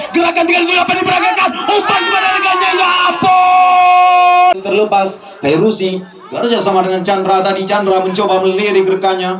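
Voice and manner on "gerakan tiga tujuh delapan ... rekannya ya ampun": excited commentary. Real, not acted. Near chance, big exclamation.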